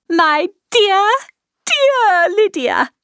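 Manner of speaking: high, loud, rapturous mother; overjoyed cry. A narrator's reading. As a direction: cry it out in violent delight, drawing out the words. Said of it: "she cried".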